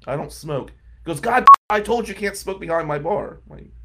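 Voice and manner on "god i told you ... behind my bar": loud, higher mock yell at the employee; retold shouting. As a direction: bark it like an angry boss. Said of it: "he starts screaming at me".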